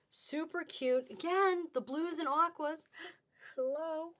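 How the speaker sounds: ordinary talk, nothing marked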